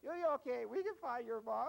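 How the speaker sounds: high, loud, frantic reassurance; hammed-up distraught comforting; overwrought rescuer. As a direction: fuss over the child in a high, loud voice. Acted distraught rescuer soothing child.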